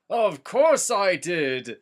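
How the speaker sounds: slow, loud, drawn-out demon growl; demonic voice. A narrator's reading. Gandalf answering Frodo, slow loud demonic voice. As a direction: say it like a demon, head spinning. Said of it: "he said like a demon".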